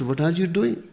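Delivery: mock incredulity. Imagines a sage laughing at someone.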